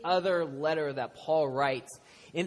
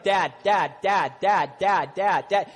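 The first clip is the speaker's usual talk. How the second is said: repeated calls, louder, insistent; child calling out; 6 dB louder, slower than usual. Retold or imitated. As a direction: keep calling Dad like a kid wanting attention. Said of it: "My son could be like".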